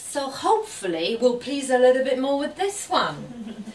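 normal talking voice

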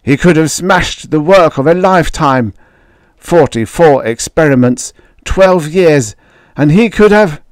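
building, the anger coming back; father raging over the near-ruined work; strange rising voice. Retold or imitated. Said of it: "in a voice I didn't know".

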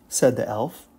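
plain reading voice

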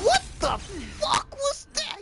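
sudden high-pitched disbelief; shocked outburst. Real, not acted. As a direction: blurt it out, stunned and high.

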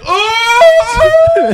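long, very high, loud wail; reenacted wail. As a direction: cry out in hammed-up horror, drawn out. Reenacts cry on soiling himself.

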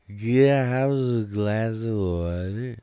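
slow, loud, slurred mumble like a stoner; heavy-tongued high mumble; stoned slurring. Retold or imitated. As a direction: drag the words out, barely forming them. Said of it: "I'm barely able to pronounce".

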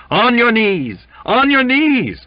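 very high, very loud screams; screaming. A narrator's reading. Cook screams at Jimmy through the door, full scream. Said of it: "The muffled screaming went on".

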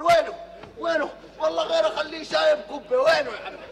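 loud repeated demand; angry demand. Acted. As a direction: bark out where is he. Demands where the old man is. (مطالبة غاضبة بصوت عال)

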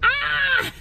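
short mock scream; high playful shriek. Real, not acted. Friend jokes "kill her.

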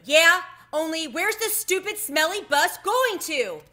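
furious yell, high and loud; stamp-your-foot tantrum shout; angry shout. Acted. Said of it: "I covered my ears and stamped my foot".

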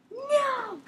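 high, stretched-out refusal; high-pitched no. Real, not acted. Boy answers someone off mic, high drawn-out no.

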